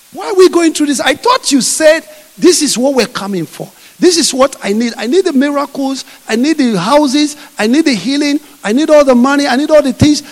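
high, loud whining complaint; complaining Israelites. Acted. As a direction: demand it of God like a grumbling crowd. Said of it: "they said".

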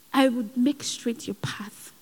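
hushed voice of God; whispered. Retold or imitated. God comforting the crying speaker, whispered. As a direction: whisper it tenderly. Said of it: "And God said".

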